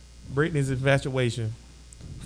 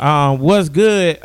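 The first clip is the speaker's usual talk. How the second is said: louder, slow drawn-out "what's good?"; big hype greeting; 10 dB louder, much slower than usual. Shown as a demonstration. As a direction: stretch "what's good?" out loud like a host hyping the crowd. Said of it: "this week we starting out like that".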